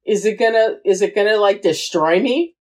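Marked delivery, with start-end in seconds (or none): none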